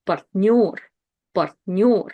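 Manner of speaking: Партнёр with the last syllable punched, "part-NYOR"; stress on Ё. Shown as a demonstration. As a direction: lean on the ё in Партнёр. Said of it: "Ё is the one that's stressed".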